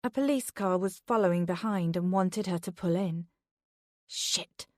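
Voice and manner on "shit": whispered curse, no voice; cursed under breath. A narrator's reading. Beth curses quietly as the police car pulls her over. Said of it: "she cursed under her breath".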